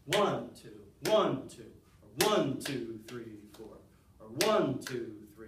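demonstrating the downbeat in twos, fours, threes; downbeat accents. Shown as a demonstration. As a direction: hit the one, drop the rest. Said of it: "That's called the downbeat".

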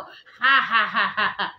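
loud mock crying wail; imitated child wail. Mother imitating her son crying in her ear, loud mock wail. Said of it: "he will be crying on your ear like".

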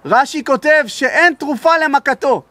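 high, impassioned pulpit voice, pressing each phrase; preaching at full force. Acted. Rabbi warns the congregation in a high, impassioned preaching voice. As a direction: rise up and preach it to the hall. Preacher drives point home.

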